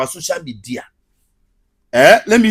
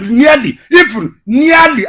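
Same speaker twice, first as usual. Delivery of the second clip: crying out; 10 semitones higher, 9 dB louder, slower than usual. Real, not acted. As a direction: voice near a cry, shouted. Near tears over losing page.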